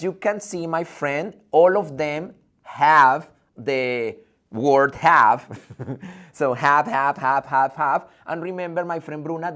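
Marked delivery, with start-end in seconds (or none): 1.5-5.5 slow, loud, every word spaced, have hit hard; slow it down and lean on have; slow loud stress on have. Shown as a demonstration. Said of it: "teacher stresses the key word". 6.3-8.1 loud repeated have, have, have; loud chant of have; drilled repetition. Shown as a demonstration. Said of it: "teacher repeats the word for the class".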